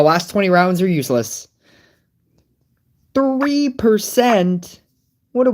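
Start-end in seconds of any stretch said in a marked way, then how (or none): 3.1-5.5 slow, high drawn-out disbelief; incredulous. Real, not acted. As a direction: stretch it out in disbelief. Reacting to low number.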